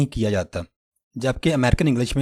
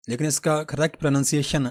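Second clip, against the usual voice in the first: ordinary talk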